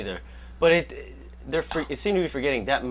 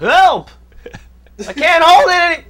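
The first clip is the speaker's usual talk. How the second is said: panicked scream for help; 13 dB louder than usual. Pretend. Acting falling worker.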